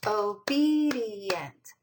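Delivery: "obedient" very slowly, with a long stretched bee; teacher stretching the syllables of "obedient"; sounded-out word. Shown as a demonstration. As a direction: draw out "obedient" as o-beee-di-ent. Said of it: "How many syllables does that have?".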